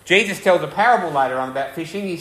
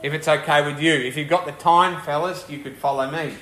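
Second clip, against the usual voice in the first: tentative polite request. Acted. Jesus imagined asking the brothers if they have time, tentative. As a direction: ask it as an optional favour, easing off at the end. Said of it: "He doesn't say it as a question".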